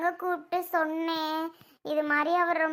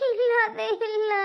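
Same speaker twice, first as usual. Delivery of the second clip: high, tearful wail; weeping as she answers; crying; 4 semitones higher than usual. Acted. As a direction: sob out the denial, drawing it out. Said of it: "ஏன் அழுதுட்டு இருக்க".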